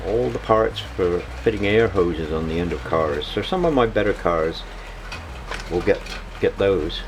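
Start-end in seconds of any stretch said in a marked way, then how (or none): none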